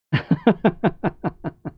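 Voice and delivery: brief amused chuckle; a quick real laugh at the chat; short laugh. Real, not acted. Reacts to Mixer viewers.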